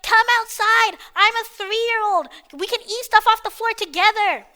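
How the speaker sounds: put-on high, loud three-year-old whine; hammed-up childish pleading; mock pestering kid. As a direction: imitate a kid nagging nonstop. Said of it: "he's spamming me nonstop".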